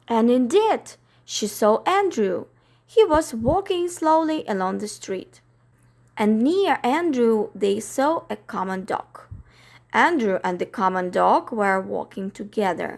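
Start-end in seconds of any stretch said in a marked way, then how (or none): none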